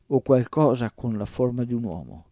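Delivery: usual speaking voice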